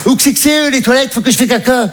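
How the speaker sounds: high mum voice; mother's voice. Retold or imitated. Mother asks her son for the toilets on the plane, high voice. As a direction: blurt it like a naive mum. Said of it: "elle fait".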